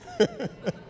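high laugh. Real, not acted. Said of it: "that's dangerous".

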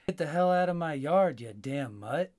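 loud angry shout at a mutt; shouting to drive a dog out of the yard; yelling at the dog. Retold or imitated. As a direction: bark it out at the dog in your yard.

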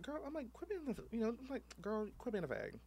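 put-on high camp sassy girl voice, swooping. Telling his friend off in a high camp sassy voice. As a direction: toss it off like a sassy queen.

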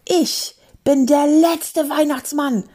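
high, drawn-out furious outburst; indignant high roar; angry roar. Acted. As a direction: bellow it slowly, pitched high. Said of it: "brüllte er".

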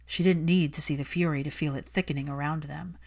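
usual speaking voice

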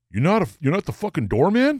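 incredulous outburst. Retold or imitated. Shocked at being charged by him.

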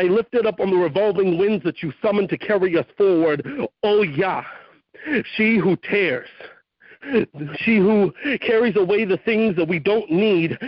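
rising fervent poem to a mother goddess; spoken-word prayer. Acted. Poet invoking the storm mother, fervent spoken word. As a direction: preach it like an invocation.

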